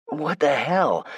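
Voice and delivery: slow, high indignant outburst. A narrator's reading. Caught beating a man, indignant and high. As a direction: stretch out the outrage, pitched high. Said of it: "he asked indignantly".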